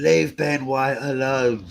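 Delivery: drawn-out mock campaign plea; campaign slogan. Fan campaigning for a favourite defender, drawn-out plea. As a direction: stretch it out like a protest slogan. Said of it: "start a campaign to get him".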